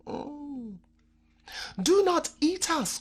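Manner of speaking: slow, pleading cry; drawn-out plea not to be eaten; cried out. A narrator's reading. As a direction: beg the cheetah slowly. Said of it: "cried Anansi".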